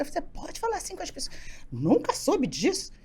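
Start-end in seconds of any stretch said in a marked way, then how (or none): 0.2-1.3 pergunta chocada, bem mais baixa e aguda; espanto contido. Retold or imitated. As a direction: repete a pergunta num tom espantado e abafado. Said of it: "Eu fiquei assustada". (recém-chegada, assustada com os gritos nas reuniões)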